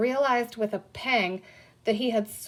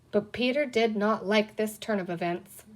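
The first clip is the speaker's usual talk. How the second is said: plain reading voice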